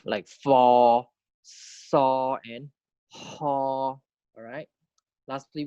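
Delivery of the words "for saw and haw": long, separated "for", "saw", "haw"; drawn-out model syllables with level vowels; Thai fricative demo. Shown as a demonstration. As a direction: stretch the opening friction of "for", "saw", "haw". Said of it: "So we have like".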